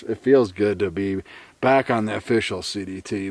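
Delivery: normal talking voice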